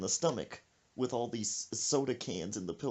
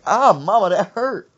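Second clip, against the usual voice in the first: loud, raised-pitch yelp of complaint; mock hurt son; 9 semitones higher, 15 dB louder than usual. Son complaining to his mama, loud mock hurt. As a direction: cry out in mock pain.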